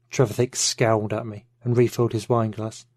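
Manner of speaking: ordinary delivery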